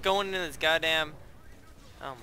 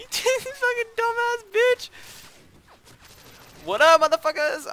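triumphant laughing yell; 9 dB louder than usual. Real, not acted. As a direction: crow over the kill.

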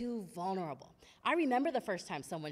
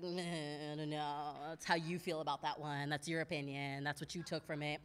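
low, soft shrug of a voice; low-key, noncommittal meh; dismissive; 6 semitones lower, 7 dB quieter than usual. Acted. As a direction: brush it off, unconvinced and quiet. Acts out dismissive listener reaction.

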